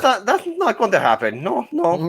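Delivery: high-pitched mock local; put-on local voice. Doubting that Texas would secede, put-on high voice. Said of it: "They talk like that down there?".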